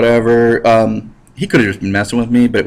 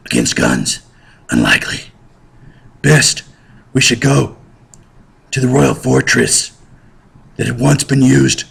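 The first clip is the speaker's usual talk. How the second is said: odd peasant voice. Acted. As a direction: talk strangely formal, like a starving peasant. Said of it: "Why are you talking like that".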